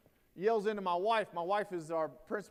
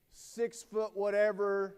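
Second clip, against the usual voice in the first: usual speaking voice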